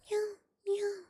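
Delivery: high mewing cry; high cat cry. Acted. Simpkin the cat crying at the shop door. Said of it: "cried Simpkin".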